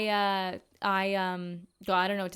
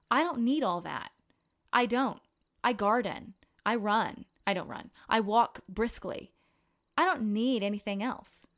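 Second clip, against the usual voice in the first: ordinary talk, nothing marked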